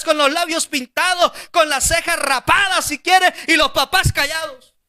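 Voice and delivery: high, impassioned preacher's cry; fiery pulpit preaching; full-force preaching. Acted. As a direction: rouse the congregation, voice up high.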